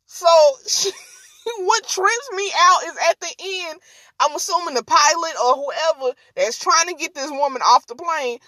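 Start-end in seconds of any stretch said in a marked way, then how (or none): none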